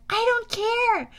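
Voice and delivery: high, drawn-out dismissal; imagine snapping back at a boring storyteller; exasperated. Retold or imitated. Hero's unspoken irritated answer.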